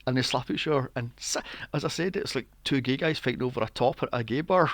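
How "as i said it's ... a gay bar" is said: usual speaking voice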